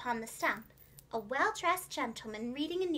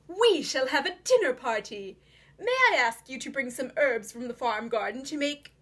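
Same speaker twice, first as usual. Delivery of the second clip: louder, hearty host voice with a hidden plan; sly fox gentleman, scheming; 4 dB louder than usual. A narrator's reading. Fox invites Jemima to a dinner party, hearty and sly. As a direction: play the charming villain ordering his own stuffing.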